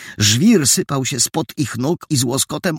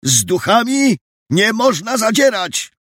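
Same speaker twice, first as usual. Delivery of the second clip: high-pitched crowd voice warning; urgent high warning; warning worker; 11 semitones higher, 3 dB louder than usual. A narrator's reading. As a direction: warn the others off, voice raised high. Frightened worker warns the others.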